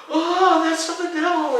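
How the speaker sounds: high, loud mock-panicked outburst; freaked-out cry. Student freaking out at his teacher's candle trick, hammed-up panic. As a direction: recoil in hammed-up alarm. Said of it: "I completely freaked out".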